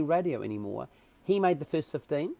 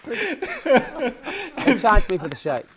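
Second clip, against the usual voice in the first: high, loud, laughing through the words; can't get it out for laughing; laughing in disbelief; 8 semitones higher, 5 dB louder than usual. Real, not acted. Telling the host about the gym fee, laughing in disbelief. Telling the protein-shake charge story.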